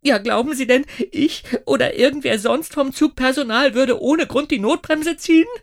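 the conductor exploding at the inspector; indignant outburst. A narrator's reading. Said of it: "faucht die Schaffnerin empört". (Schaffnerin, empört und viel lauter)

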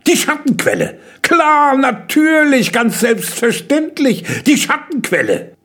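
high, loud, gleeful exclamation; delighted outcry. A narrator's reading. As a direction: cry out as the answer strikes you. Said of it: "schrie er vergnügt auf".

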